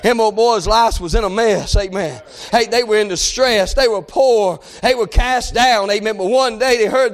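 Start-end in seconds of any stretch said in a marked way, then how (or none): none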